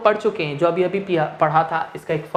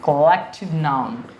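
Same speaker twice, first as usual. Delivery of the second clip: collective noun drawn out very slowly; slow term; 2 semitones lower, much slower than usual. Shown as a demonstration. Teacher to students, introducing the new term very slowly. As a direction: stretch the term out for the class. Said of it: "that is called".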